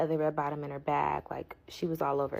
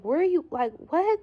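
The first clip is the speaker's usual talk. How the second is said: frantic calling; 11 semitones higher, 6 dB louder than usual. Retold or imitated. Frantic question to lost friend.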